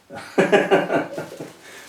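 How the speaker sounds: high, breathy burst of laughter; amused laugh. Real, not acted. Grower unsure which of three plants it is, amused laugh. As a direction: laugh at your own muddle.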